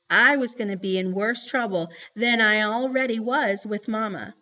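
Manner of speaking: usual speaking voice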